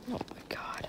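voiceless, defeated whisper; whispered oh my god. Real, not acted. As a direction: breathe it out in dismay. Watches a beginner fall.

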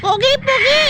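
very high squeaky coaxing call; high pet-talk voice; high bird-talk. Real, not acted. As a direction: sing it up high to coax a bird. Praising the parrot repeatedly.

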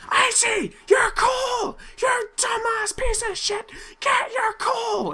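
imitating viewers yelling about his coal, mock shouting; mock viewers screaming. Shrill mocking viewer shout. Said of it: "You guys are probably screaming at me".

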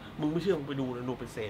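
low, soft, serious reply; quiet insistence. Retold or imitated. Norris telling his colleague to look, quiet insistence. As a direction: calmly dare the doubter to check.

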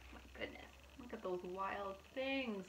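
hushed whispered wonder; whispered awe. Real, not acted. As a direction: whisper in amazement. Awed aside to viewers.

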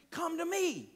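lifted, tender divine voice; voice of Jesus. Retold or imitated. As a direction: speak as Jesus embracing them. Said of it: "He's like".

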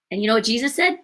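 ordinary talk, nothing marked

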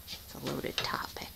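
hushed, conspiratorial whisper; whispered aside. Real, not acted. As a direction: drop to a secretive whisper. Wary aside about a touchy subject.

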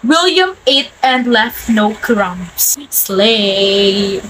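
slow, drawn-out sassy praise; sassy slang. Acted. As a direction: hype him up with sass. Teasing Gen-Z slang.

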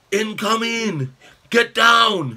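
high, urgent shout, building in volume; yelled warning. Acted. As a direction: yell for everyone to take cover. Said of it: "Hideki yelled".

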